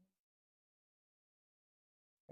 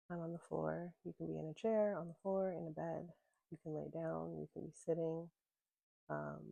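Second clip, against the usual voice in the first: usual speaking voice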